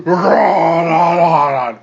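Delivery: loud, drawn-out roar of "rah"; big bellowed creature noise; monster roar. Acted. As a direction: roar it out like a raging brute. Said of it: "Crud goes".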